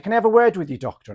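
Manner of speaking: high, loud opening that drops to normal; acted calling out. Patient asking the doctor for a word, calling out. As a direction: call for attention, then settle. Acted request to psychiatrist on ward.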